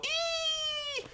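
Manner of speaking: very high, thin squeal; mock fangirl squeal. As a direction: squeal like swooning ladies. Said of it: "the ladies would go".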